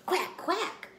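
high quacking; duck quack. Acted. Ducks answer kitten with high quacks. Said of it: "said the ducks".

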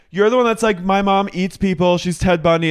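loud, high retold charge; mock accusation. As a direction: act out an outraged accuser.

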